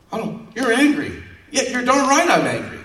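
high, loud indignant comeback; mock angry retort. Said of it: "you're like".

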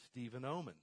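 ordinary talk, nothing marked

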